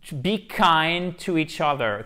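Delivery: "To be kind to each other" said slowly and clearly; a teacher's careful English model; slow model phrase. Shown as a demonstration. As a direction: spell the phrase out slowly for the class. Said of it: "une injonction".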